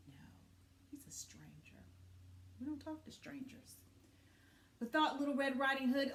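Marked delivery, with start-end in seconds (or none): 0.1-3.8 hushed warning aside; whisper. A narrator's reading. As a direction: confide it to the children. Aside to listeners.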